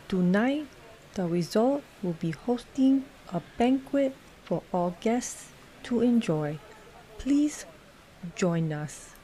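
slow, soft robot voice; robotic voice. A narrator's reading. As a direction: speak robotically, mechanically. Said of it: "the bartender said robotically".

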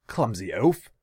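loud angry snap; snapping. A narrator's reading. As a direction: bark the insult. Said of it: "Tasigur snapped".

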